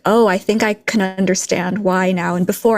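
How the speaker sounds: ordinary delivery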